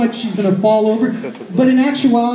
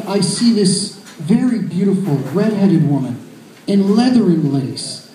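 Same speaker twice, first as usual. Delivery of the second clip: ordinary talk, nothing marked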